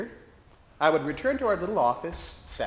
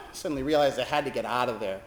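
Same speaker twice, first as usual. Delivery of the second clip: normal talking voice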